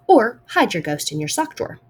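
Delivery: plain reading voice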